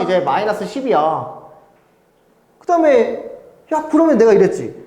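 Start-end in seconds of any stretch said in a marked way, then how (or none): none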